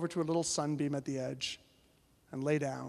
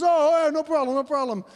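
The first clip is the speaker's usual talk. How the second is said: loud reassurance; 8 semitones higher, 9 dB louder than usual. Retold or imitated. Retold man brushes off refusal.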